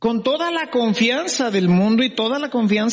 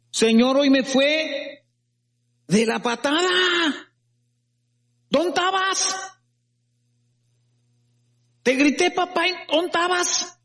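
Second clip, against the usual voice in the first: high, whining complaint to God; complaining to God; 8 semitones higher than usual. Shown as a demonstration. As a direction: plead and reproach like a hurt child. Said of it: "A quejarnos". (queja lastimera y aguda a papá Dios)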